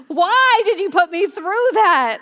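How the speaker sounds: put-on high, loud and slow, mad at the kids; angry parent. Mother scolding her found son, angry and loud. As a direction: let the anger ring out high. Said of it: "I was so mad".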